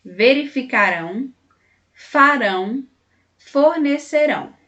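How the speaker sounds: "Verificarão, farão, fornecerão" with strong -RÃO endings; stressed final -ão. Shown as a demonstration. Teacher reading future verbs for their final sound, stressed -ão in "Verificarão, farão, fornecerão". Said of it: "Preste atenção ao som final deles".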